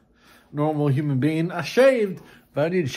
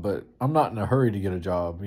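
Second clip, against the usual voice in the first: ordinary delivery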